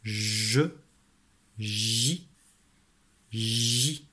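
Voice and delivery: "je, ji, ji." each held with a soft zh onset; soft g syllables. Shown as a demonstration. Teacher contrasting the g rule for children, soft g syllables. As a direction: draw out "je, ji, ji." one by one. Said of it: "alors qu'ici on a".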